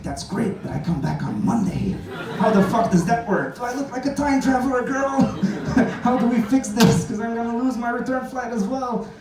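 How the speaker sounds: high, indignant, incredulous complaint; high sassy outrage at the desk; exasperated rant. Retold or imitated. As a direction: sass the agent in disbelief. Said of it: "And I'm like".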